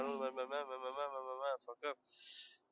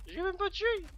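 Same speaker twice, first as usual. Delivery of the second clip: indignant outburst; 5 dB louder than usual. Real, not acted. Indignant at the game.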